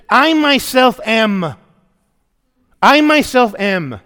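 slow, heavy weight on "I myself am"; stress on I. Shown as a demonstration. Pastor stressing Jesus' claim for the congregation, slow and emphatic. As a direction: press "I" hard and stretch "I myself am". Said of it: "Emphatic construction".

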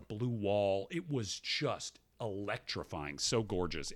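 low, soft, awestruck murmur; hushed awe. Real, not acted. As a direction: drop low and quiet, savouring the wonder. Marvelling at his slide photos.

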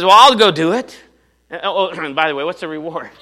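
high, loud and eager; young David loudly offering to fight; eager shout. Acted. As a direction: jump in to volunteer, then slyly ask the prize.

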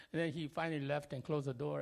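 ordinary talk

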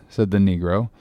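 plain reading voice

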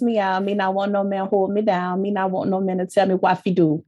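imitated Jamaican accent; Jamaican patois. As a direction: read it like a Jamaican would say it. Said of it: "It's spelt in accent".

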